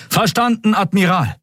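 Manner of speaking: high, keyed-up military reply; crew's acknowledgement. A narrator's reading. Crew echoing the admiral's attack order, high and keyed-up. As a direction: answer as one of the troops, pitched right up.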